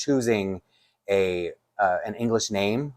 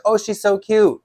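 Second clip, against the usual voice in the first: much higher, excited squeal of delight; gushing best friend; 12 semitones higher, 4 dB louder, slower than usual. Retold or imitated. Best friend cooing over the cute doll, high and excited.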